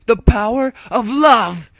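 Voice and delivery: high, loud triumphant shout; cried out. A narrator's reading. Dash crying out at Omega, high and loud. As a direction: belt it like a battle cry. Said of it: "Dash cried".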